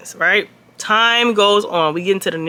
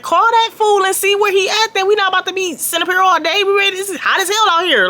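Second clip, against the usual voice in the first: impatient complaint; 10 semitones higher than usual. Retold or imitated. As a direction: hurry her up, fed up with the heat. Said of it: "I was like".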